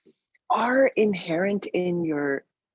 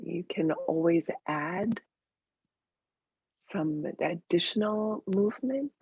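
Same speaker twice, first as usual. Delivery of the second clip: guided meditation; 4 dB quieter than usual. Real, not acted. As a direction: lead them gently into the breath, unhurried.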